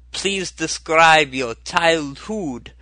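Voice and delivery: put-on slow, high, half-hearted stab at an Austrian accent; failed Austrian accent. Podcaster mimics a Freudian analyst asking about childhood, in a botched Austrian accent. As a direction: drag it out like a bad stage Viennese analyst. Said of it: "I can't do an Austrian accent".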